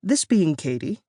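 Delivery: usual speaking voice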